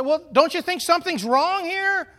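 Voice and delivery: high, loud mock reproach; the donkey scolding its rider. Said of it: "He said".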